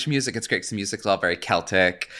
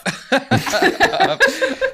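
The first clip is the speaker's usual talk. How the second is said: high burst of laughter; 3 dB louder than usual. Real, not acted. Laughs at joke.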